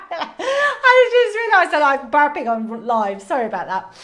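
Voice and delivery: laughing loudly through the words. Real, not acted. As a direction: speak while laughing hard. Laughing at burping on live.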